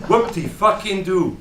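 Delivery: drawn-out, loud, high mock celebration; sarcastic cheer. Eavesdropper mocking the old bore's boasting, loud sarcastic cheer. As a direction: scoff with slow, exaggerated fake delight.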